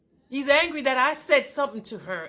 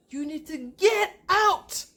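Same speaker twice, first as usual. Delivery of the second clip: high, drawn-out, held-back yell; retold scream; 7 semitones higher, 3 dB louder, slower than usual. Wife throwing husband out, retold scream. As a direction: stretch GET OUT into a restrained shout. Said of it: "I screamed louder than that".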